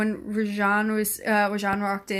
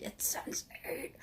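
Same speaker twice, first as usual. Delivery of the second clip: slow, hushed and secretive; slow mock secret whisper; knowing whisper; 6 semitones higher, 13 dB quieter, slower than usual. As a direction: whisper it like an insider. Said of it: "And then you're like".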